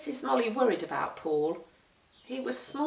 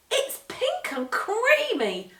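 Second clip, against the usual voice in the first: much higher, slow and marvelling; amazed wonder; 12 semitones higher, 5 dB louder, slower than usual. Acted. Jenny marvelling at the pink food in the pot. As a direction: marvel at it like a child.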